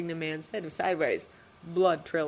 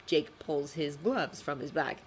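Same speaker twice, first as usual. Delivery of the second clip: ordinary delivery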